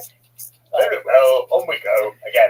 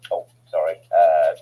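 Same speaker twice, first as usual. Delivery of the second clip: usual speaking voice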